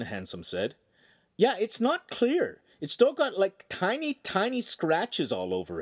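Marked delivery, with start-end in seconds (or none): 1.4-5.8 put-on boy's voice. Boy voiced in story.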